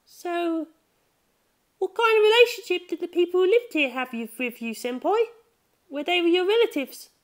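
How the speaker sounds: put-on high curious girl voice; Chiho, high girl voice. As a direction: pitch way up like a curious schoolgirl. Narrator voices Chiho asking Senpai.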